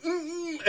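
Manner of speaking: high, soft closed-mouth squeal; squeaky muffled "mm"; stifled laughter hum. Retold or imitated. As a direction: imitate holding in a laugh. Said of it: "will try to hold it".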